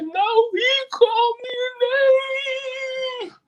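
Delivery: high, slow imitated sobbing girl; crying child. A crying girl reporting a classmate, imitated wail. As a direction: wail it like a little girl in tears. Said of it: "Stop crying, Jamisha".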